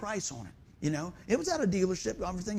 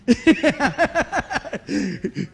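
loud full-bodied laugh; hearty laugh; 6 semitones higher, 9 dB louder than usual. Real, not acted. Congregation joke, big laugh.

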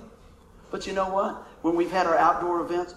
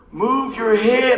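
inner voice; 4 semitones higher, 5 dB louder, much slower than usual. Retold or imitated. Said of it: "this voice says".

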